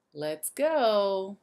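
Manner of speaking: ordinary delivery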